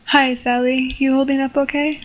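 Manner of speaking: plain reading voice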